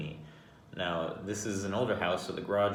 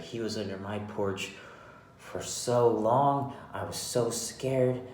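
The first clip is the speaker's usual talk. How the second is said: imitated complaining old lady; 3 semitones higher, slower than usual. Old lady telling neighbours about the stranger, exaggerated fright. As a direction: stretch out the complaint, imitating her.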